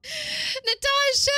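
high, drawn-out name call; excited greeting. Real, not acted. Streamer greeting a viewer, high excited call.

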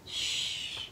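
plain reading voice

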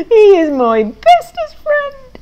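high, slow, laughing-crying voice; tearful joy. Acted. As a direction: gush through happy tears. Pig moved by Farmer.

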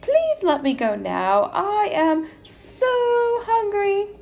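high, pleading little goat. A narrator's reading. Hungry little goat begging the troll, high little-goat plea. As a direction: plead high, drawing out the end. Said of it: "in his little billy goat voice".

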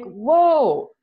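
a mock stunned whoa; taken aback. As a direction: replay your own stunned reaction. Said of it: "And I'm like".

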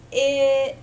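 "It" split into a long vowel, then a separate t; slow, stretched out. Shown as a demonstration. Teacher gives students a blending puzzle, "It" said slowly in pieces. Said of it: "I'm gonna say the word slow".

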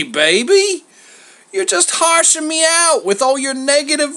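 high, loud, whining mock offence; theatrical wounded protest from a schmoozer; mock hurt. A narrator's reading. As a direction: play the wounded pal, hammed up. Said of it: "put on a mock look of hurt".

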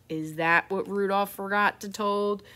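usual speaking voice

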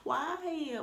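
usual speaking voice

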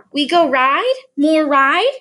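put-on a toddler begging for a ride.

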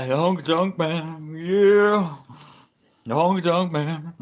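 loud, drawn-out wrestler impression; hammed-up, stretched catchphrase; Honky Tonk Man. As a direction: belt out the name like a showman. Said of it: "Honky Tonk Man impression there".

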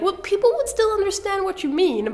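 high-pitched mock-reassuring voice; kinda nice. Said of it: "I wanted to be kinda nice and be like".

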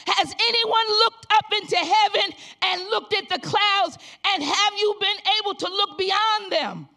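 loud, rising revival preaching; preaching at full force. Acted. Pastor exhorting the congregation, loud full-force preaching.

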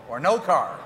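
usual speaking voice

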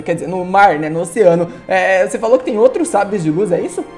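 normal talking voice

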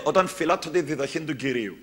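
ordinary talk

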